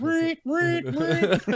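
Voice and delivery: high squeaky alarm-like "reet, reet, reet"; mock alarm noise. Telling a friend about his panic, he makes a high squeaky alarm noise. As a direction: sound off like an alarm going off in your head. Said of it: "And I immediately went".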